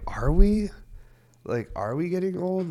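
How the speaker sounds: high, loud disbelieving question; incredulous. Retold or imitated. Incredulous reply to wife.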